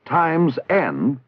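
usual speaking voice

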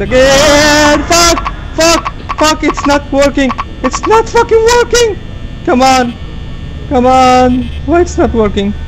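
high frantic yelling; panicked screaming. Real, not acted. Panicking as button fails.

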